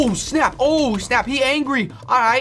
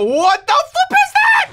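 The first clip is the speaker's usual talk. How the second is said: startled yell; 6 dB louder than usual. Real, not acted. Sees huge robot wave.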